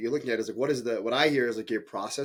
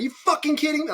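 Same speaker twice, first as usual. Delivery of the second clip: high-pitched, louder mock outburst; angry disbelief; 5 dB louder than usual. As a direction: act out losing your temper at an employee. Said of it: "I get really mad".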